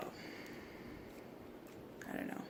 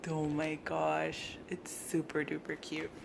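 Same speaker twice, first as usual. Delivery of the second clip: slow soft whisper; 2 semitones lower, 5 dB quieter than usual. Real, not acted. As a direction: breathe it out in awe. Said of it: "I don't know why I'm whispering".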